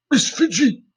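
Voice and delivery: high strained call through coughs; choking man calls for Fidgy; hoarse choking call. A narrator's reading. Bert choking in smoke.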